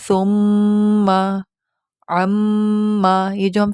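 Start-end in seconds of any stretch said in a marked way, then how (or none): 0.0-1.1 "ثم" with the doubled میم hummed long, lips closed; held nasal ghunna. Shown as a demonstration. Teacher demonstrating doubled meem, long nasal hum. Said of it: "پروناؤنس کریں نا نون اور میم کی، اس کو غنہ کہتے ہیں". 2.1-3.1 "ثم" with the doubled میم hummed long, lips closed; held nasal ghunna. Shown as a demonstration. Teacher demonstrating doubled meem, long nasal hum. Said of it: "پروناؤنس کریں نا نون اور میم کی، اس کو غنہ کہتے ہیں".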